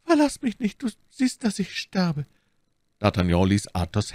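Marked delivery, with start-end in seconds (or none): 0.0-2.2 very high, pleading female voice; dying woman. A narrator's reading. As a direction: plead as your strength fades. Dying woman pleads with her lover. (hohe, flehende Stimme einer Sterbenden)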